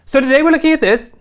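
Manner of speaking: high, loud bursts with gaps between; pre-edited vlogger delivery. Retold or imitated. Host mimicking creators who record in chunks, high pre-edited bursts. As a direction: fire off each phrase as a separate clip. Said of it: "they speak in a way that almost preempts the edit".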